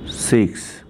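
ordinary talk

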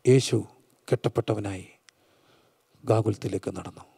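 low, soft, with long pauses; hushed preacher storytelling. Acted. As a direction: tell it gravely, almost in a whisper. Hushed dramatic storytelling in sermon.

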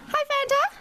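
very high, bright greeting; greeting pony. Real, not acted. Greeting her pony in a high, bright voice. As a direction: sing the hello up high.

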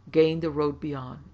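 ordinary delivery

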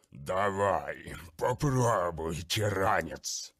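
slow, gurgling, mocking dare; gurgling monster taunt. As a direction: goad him like a bloated beast. (булькающая насмешка чудовища)

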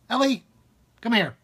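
loud high call across the house; calling the dog. Real, not acted. Owner calling the barking dog over, loud and high. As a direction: shout for the dog to come.

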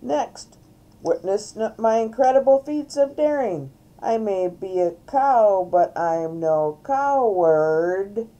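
loud, grand circus-style announcing; showy cow entertainer. Acted. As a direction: proclaim your daring to the crowd. Reader plays Mary Moo Cow performing.

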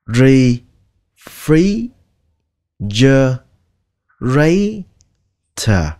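refrigerator broken into slow, separate syllables. Shown as a demonstration. Teacher breaks the word down for learners, slowly. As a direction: sound out refrigerator slowly with long gaps. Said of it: "các bạn đừng có kéo dài".

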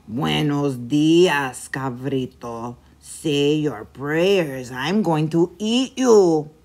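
low, slow, drawn-out monster greeting; a sly monster savoring its prey; menacing chupacabra. A narrator's reading. Chupacabra greets the little goat, slow low menace.

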